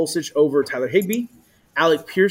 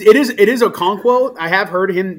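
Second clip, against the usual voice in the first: usual speaking voice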